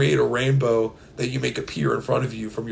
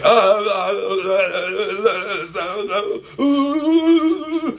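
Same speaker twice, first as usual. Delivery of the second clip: glitchy stutter; 8 semitones higher, 3 dB louder, much slower than usual. Pretend. Glitching through a portal.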